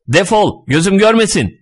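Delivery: high, furious dismissal; high-pitched angry outburst; angry shout. A narrator's reading. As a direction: yell them out of your sight. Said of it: "diye bağırdı".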